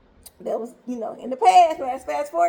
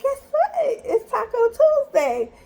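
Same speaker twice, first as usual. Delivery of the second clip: high, bright-eyed build-up with a laugh; laughing, hyped mom teasing the surprise; excited announcement; 9 semitones higher than usual. Retold or imitated. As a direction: announce it like a treat. Excited mom announcing taco night.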